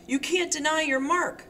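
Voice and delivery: a rising, frantic plea; pleading insistence. Acted. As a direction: insist desperately, refusing to let go. Helen clinging, insisting he's Mark.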